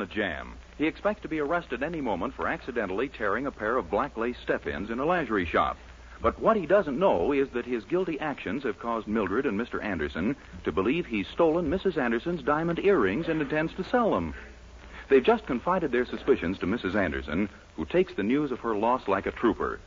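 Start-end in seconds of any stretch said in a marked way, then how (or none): none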